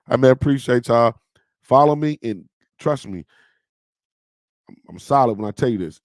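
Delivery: usual speaking voice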